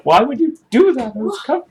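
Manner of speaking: high squeaky offended cat voice. Retold or imitated. Owner voices the cat, high and offended. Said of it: "she's like".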